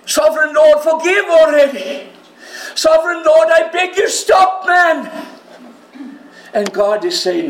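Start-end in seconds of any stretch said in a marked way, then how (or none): 0.0-5.1 high, loud begging shout; frantic plea, near shouting; desperate pleading. Acted. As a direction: beg him to stop, all out. Amos pleading again.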